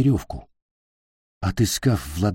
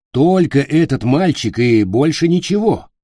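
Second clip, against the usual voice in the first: urgent insistence; 6 semitones higher, 5 dB louder than usual. A narrator's reading. Captain insists facing a sword.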